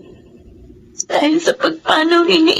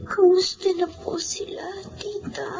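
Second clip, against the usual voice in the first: whispered, barely there; weak whisper; 9 dB quieter than usual. Acted. Injured girl asking her aunt about parents, whispering. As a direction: ask in a faint whisper.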